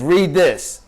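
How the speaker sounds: mock-stern push of a book; friend's firm command. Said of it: "He said".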